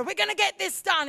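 loud driving go-getter; mock autocrat charging ahead; powering visionary. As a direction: push it out with force. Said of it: "autocratic or visionary, they just power out there".

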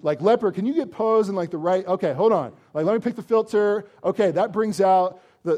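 hammed-up influencer begging to post the miracle; hyped social media fan. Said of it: "for me, I'm like".

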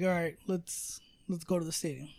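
a low-key mumble to yourself; quiet retelling. Retold or imitated. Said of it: "where I'm like".

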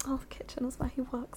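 unvoiced secretive whisper; whispered thought. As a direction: breathe it like a private wish. Secret private thought quoted.

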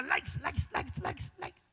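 whispered likes trailing into silence; fading whisper. Acted. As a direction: let it fade to a breath. Repeating likes, fading away.

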